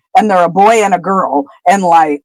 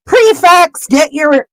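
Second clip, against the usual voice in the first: raised-pitch commanding headmaster call; Dumbledore; 7 semitones higher, slower than usual. Acted. Headmaster orders prefects after the alarm, acted commanding call. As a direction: call out the order to the whole hall. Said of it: "Dumbledore silences everybody and then orders".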